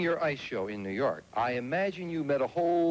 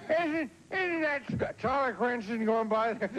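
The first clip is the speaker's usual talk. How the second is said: passer-by voice; 9 semitones higher, slower than usual. Pretend. Host mimics a passer-by.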